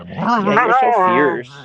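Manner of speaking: slow, high, loud gushing pet voice; baby talk to dog. Acted. Streamer praising her fierce little dog, loud high coo. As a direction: gush over the dog slowly and high.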